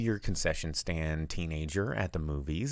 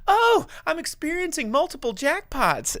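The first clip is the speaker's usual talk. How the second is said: high, loud theatrical exclamation; put-on excited voice, pitched way up; Cassandra Peterson impression; 7 dB louder than usual. Host imitating a pinball machine's jackpot callout, theatrically high. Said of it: "all of these Cassandra Peterson-isms coming".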